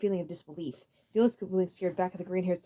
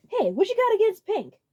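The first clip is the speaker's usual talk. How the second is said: tough Roy voice; 14 semitones higher, 8 dB louder than usual. A narrator's reading.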